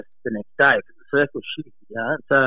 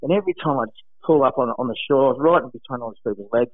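normal talking voice

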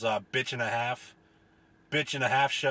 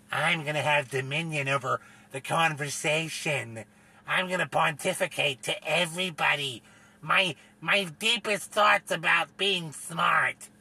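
lightly voiced pompous blowhard, slowing at the end; hammed-up self-important declaration; pompous mock voice; 2 semitones higher than usual. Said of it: "it's like".

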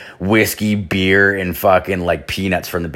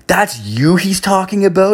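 much higher, surprised waitress voice; 11 semitones higher, 3 dB louder than usual. Retold or imitated. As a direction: play the waitress, startled and high.

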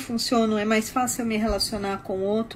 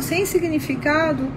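ordinary delivery

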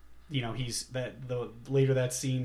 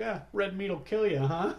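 higher-pitched, hammed-up casual line; cheesy quip; 6 semitones higher than usual. Rescuer quipping after saving a choking man, cheesy. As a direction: toss it off like a corny movie hero. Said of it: "saying all these, like, cheesy lines".

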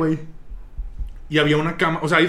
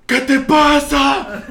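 retold outburst; 10 semitones higher, 6 dB louder, much slower than usual. Yelling at himself, retold.

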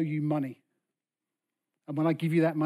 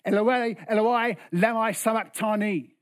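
raised, loud cry to God, short of a scream; 4 semitones higher, 3 dB louder than usual. Acted. Jesus calling to God from the cross, restrained cry. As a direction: call it out in anguish, restrained. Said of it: "Jesus screamed out".

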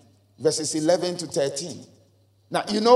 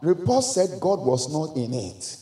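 ordinary delivery

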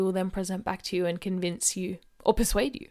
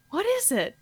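squeaky surprised customer; high-pitched radio ad character; 12 semitones higher than usual. Acted. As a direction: ask it up high in wonder. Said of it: "two people in conversation".

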